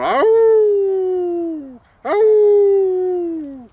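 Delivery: very high, loud, long hound howl; awful hound howl. A narrator's reading. Old hound in the barnyard, awful high howling. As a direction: howl like a miserable old dog. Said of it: "You do sound awful".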